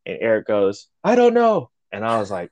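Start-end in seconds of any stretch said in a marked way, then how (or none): 1.0-1.7 high, loud blurted answer; mock panicked outburst. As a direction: imitate someone cracking under a chief's grilling. Eric answers the yelling chief.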